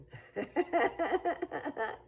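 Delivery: a high, bright burst of laughter; amused high laugh. Real, not acted. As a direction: laugh up high at your own joke. Laughs at own quote.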